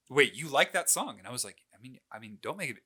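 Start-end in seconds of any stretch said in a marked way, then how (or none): 0.1-1.2 mom's startled question, a bit louder; disbelieving double take at her son; surprised mom. Retold or imitated. Mom surprised her son likes a song, louder. 1.7-2.8 sheepish, much softer mumble; backpedal, shy and quiet; embarrassed mumble. Retold or imitated. Boy mumbling to his mom about liking a song, sheepish.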